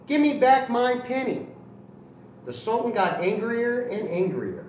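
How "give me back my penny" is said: louder, higher, insistent demand; demanding cry. Acted. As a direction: demand the penny back like a stubborn rooster. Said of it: "he cried".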